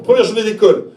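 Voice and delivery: ordinary delivery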